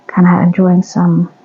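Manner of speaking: usual speaking voice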